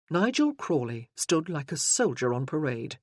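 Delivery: normal talking voice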